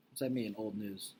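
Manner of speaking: plain reading voice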